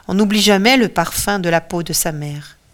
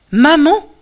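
higher, louder cry to a parent; sudden raised call of "mother"; 8 semitones higher, 7 dB louder than usual. A narrator's reading. Ulysses spots his dead mother among the shades, crying out. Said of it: "crie-t-il".

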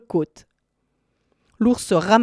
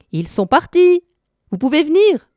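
much higher, louder shout to friends outside; calling out; 9 semitones higher, 4 dB louder than usual. Acted. Cat calling companions back into the house, joyful shout. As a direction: call them in, triumphant. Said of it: "et cria aux autres".

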